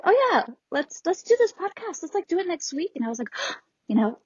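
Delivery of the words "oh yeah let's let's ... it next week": bright, eager suggestion; excited friend. Retold or imitated. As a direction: pitch the idea eagerly. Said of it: "And you were like".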